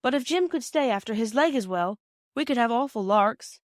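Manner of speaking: put-on a boy pleading his case with his mother; hint of an excited schoolboy; eager boy. Narrator voices eager boy.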